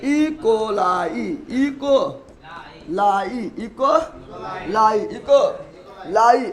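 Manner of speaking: each ई coli stretched very slowly; drawn-out drill. Shown as a demonstration. Teacher drills ई coli to students, very slowly. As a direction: drag out ई coli for the class to copy. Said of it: "क्या बोलते हैं?".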